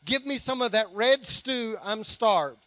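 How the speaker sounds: preacher reading Genesis aloud to the church; scripture reading. Acted. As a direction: read the passage out to the congregation with weight.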